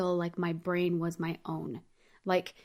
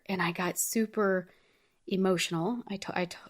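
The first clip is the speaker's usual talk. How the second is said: ordinary talk, nothing marked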